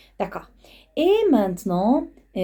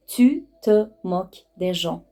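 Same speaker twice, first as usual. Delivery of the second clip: slow word-by-word teaching delivery; measured, separated words at normal pitch; slow articulation; much slower than usual. Shown as a demonstration. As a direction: space out each word for learners. Same phrase broken down for learners.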